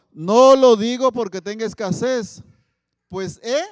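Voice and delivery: loud, slow, declaiming the verse; solemn scripture reading. Acted. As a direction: proclaim it from the pulpit. San Pablo quoted from the Bible. (lectura bíblica solemne, fuerte y lenta)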